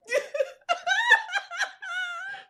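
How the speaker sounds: high shrieking laugh into tears; crying laughter. Real, not acted. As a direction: laugh until the tears come. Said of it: "I'm crying".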